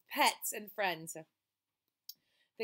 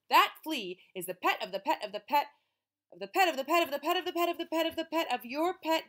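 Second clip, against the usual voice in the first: louder and higher with each "of the pet"; building exasperation; 7 semitones higher, 7 dB louder, faster than usual. Acted. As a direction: pile it up faster and more frantic.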